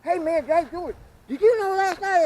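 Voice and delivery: high loud mock phone gossip; mock phone chatter. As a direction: ham up a loud phone caller.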